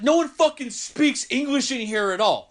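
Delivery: high, irritated complaint; heated. Retold or imitated. Heated guy complaining to the narrator that nobody speaks English, high and angry. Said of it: "the guy's fucking heated".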